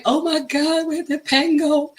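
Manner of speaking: high, hushed fake alarm; mock panic over a pen. Supervisor panicking over a lost pen, high hushed mock alarm. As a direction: gasp it like a lost pen is a disaster. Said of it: "I'm like".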